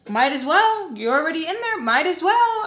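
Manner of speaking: high, loud mock reply; mocking imitation. Retold mother's offhand reply.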